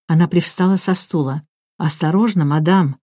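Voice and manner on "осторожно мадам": usual speaking voice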